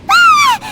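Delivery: sharp high startled shriek; startled scream. Acted. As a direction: jolt into a scream. Sudden attack startles Paimon.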